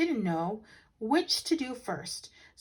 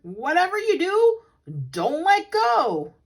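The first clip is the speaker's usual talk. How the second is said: high, loud, drawn-out urgent plea; shouting; 10 semitones higher, 9 dB louder, slower than usual. Acted. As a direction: hammer the warning home, slowly. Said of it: "shouted the ringmaster".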